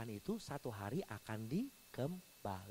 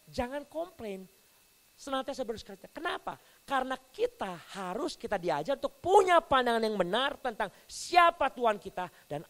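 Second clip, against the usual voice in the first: preaching at full force; 10 dB louder than usual. Acted. Pastor exhorting his church, preaching at full force. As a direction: drive each point home to the crowd.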